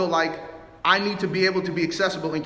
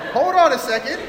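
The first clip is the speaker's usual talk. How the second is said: higher, louder, caught off guard; startled interjection; 8 semitones higher, 6 dB louder than usual. Retold or imitated. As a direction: pull up short, taken aback. Startled reaction to the outburst.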